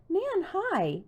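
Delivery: high, bright burst of a greeting; a lightly voiced eager friend; excited greeting. A narrator's reading. Bess bursting in at the door.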